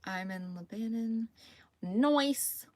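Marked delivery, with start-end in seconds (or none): 1.8-2.7 "Noise" said as noice, with a hissed s ending; slang "noice". Real, not acted. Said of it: "Does anyone ever say noise anymore".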